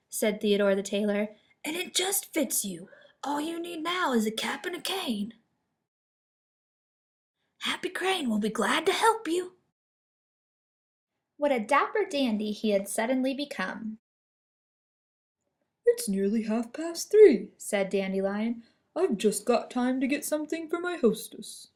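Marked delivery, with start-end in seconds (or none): 1.6-9.6 lightly voiced fussy tailor. A narrator's reading. Tailor Theodore speaks. 15.8-17.5 louder, lightly voiced lion; a hint of a hurried lion. A narrator's reading. Dandelion speaks in story. 18.9-21.7 lightly voiced lion; a hint of a cheerful lion. A narrator's reading. Dandelion speaks in story.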